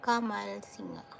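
normal talking voice

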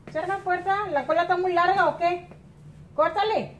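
high, loud stern orders; firm mom laying down the law; stern scolding. Real, not acted. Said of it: "si no me lo pongo seria".

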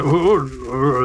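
croaky toad grunts; grumpy toad croak. Acted. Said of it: "they go like".

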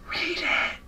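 imitated urgent stage whisper; loud mock whisper. Classmate insisting from nearby in class, exaggerated loud whisper. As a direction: whisper it forcefully, all breath. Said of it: "he's, like, whispering loud as shit".

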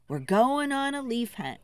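usual speaking voice

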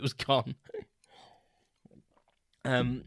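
while sipping a drink; drinking Coke. Real, not acted. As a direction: talk around a sip of Coke. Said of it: "sorry, I'm drinking Coke again".

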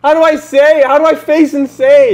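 high, loud frantic asking; panicked question. Real, not acted. Player panics asking how to save. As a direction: beg for help in a panic.

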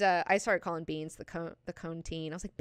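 usual speaking voice

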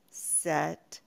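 ordinary talk, nothing marked

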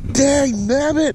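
high, slow cry of frustration; frustrated mild curse; exasperated. Real, not acted. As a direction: draw it out, pitch jumping up in annoyance. Reel seizing up.

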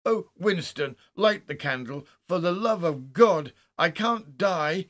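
high, loud, desperate pleading; broken-voiced terror. A narrator's reading. Haldane begging Winston for light in the dark, desperate and terrified. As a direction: build from pleading to a frantic cry. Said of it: "his voice broke, as a boy's does".

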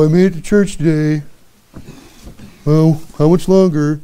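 mock a churchgoer who can't wait to leave; sighing about the length of the service; bored churchgoer. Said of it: "is that the attitude we have".

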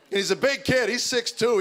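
usual speaking voice

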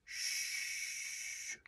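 quiet unvoiced shh; soft shushing. Shown as a demonstration. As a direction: gently hush it away. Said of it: "try it with me".

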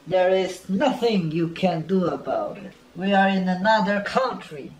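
normal talking voice

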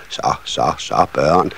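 soft, low hushing voice; calm the children down, low and soft; soothing mother. A narrator's reading. Nissemor hushing her excited children, soft and soothing.

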